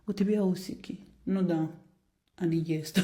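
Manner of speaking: normal talking voice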